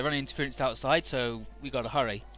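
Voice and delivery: normal talking voice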